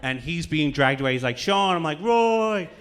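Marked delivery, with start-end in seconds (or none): 1.4-1.8 higher, louder farewell cry; mock dramatic call. Roy dragged away, calling. 2.0-2.7 higher, louder anguished call back; mock dramatic call.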